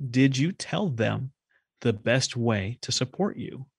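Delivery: slowed, pointed question to a friend. Retold or imitated. Asking a frustrated guest, slow pointed question. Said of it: "I said".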